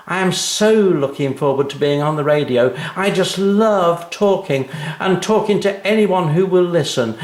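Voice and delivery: put-on high, loud, show-off girl's voice; a smug schoolgirl bragging; smug and boastful. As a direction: gloat over the poem she wrote. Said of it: "smiling and looking smug and clever".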